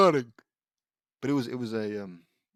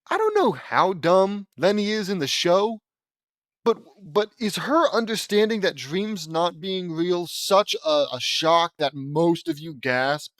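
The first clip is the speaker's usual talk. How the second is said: incredulous rant; 5 semitones higher, 5 dB louder than usual. Real, not acted. Ranting at the fanfic.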